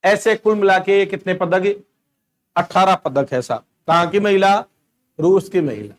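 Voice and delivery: normal talking voice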